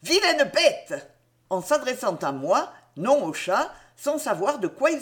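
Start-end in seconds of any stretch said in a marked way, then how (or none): 0.0-1.1 high, slow scolding exclamation; startled outcry. A narrator's reading. Aunt waking with a start to scold, crying out. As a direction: cry out indignantly, drawing out the words. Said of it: "se réveilla en sursaut et s'écria".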